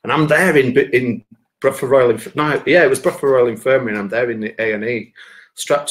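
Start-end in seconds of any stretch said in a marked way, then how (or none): none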